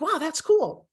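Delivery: high-pitched pleasant wow; acted delighted surprise; impressed listener. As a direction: react as if wowed by a name. Imagined impressed listener reacts.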